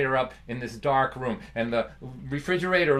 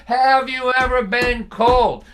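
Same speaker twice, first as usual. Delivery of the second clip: slow, loud, higher stern dad voice; stern father; 9 semitones higher, 9 dB louder, slower than usual. Retold or imitated. Father grilling his son, slow, loud and stern. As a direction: lean on the question like a tough father.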